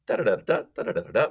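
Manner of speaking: melody hummed on da, rising twice; playful FBI negotiator lilt; playful curious tune. Shown as a demonstration. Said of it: "playful, curious voice goes up".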